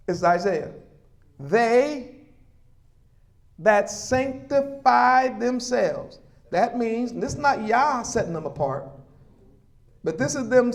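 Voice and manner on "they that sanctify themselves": slow, deliberate Scripture reading; read slowly. Shown as a demonstration. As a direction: drag out "They" and "sanctify" so all can follow. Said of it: "I'm gonna read it slow so everybody can catch it".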